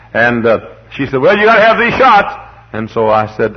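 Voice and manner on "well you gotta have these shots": high, louder mock nurse asking; storyteller doing the nurse, high and bright. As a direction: play the chirpy nurse, pitched way up.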